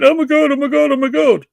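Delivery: high, loud flustered squeal, mocking; mimicking a frantic woman's panic; panicky mock voice. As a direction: flap like a headless chicken. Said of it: "running around like a mad fucking chicken going".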